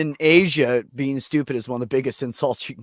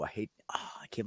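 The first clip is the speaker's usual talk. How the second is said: breathy hushed recoil from a bad sound; soft whispered shudder of disgust; cringing whisper; 4 semitones lower, 16 dB quieter, faster than usual. Real, not acted. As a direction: whisper it through a real cringe.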